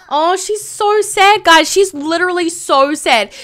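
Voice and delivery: high, slow, drawn-out pity; high pitying moan; pitying whine. Real, not acted. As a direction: coo over a sad little Sim. Reacting to sad Sim.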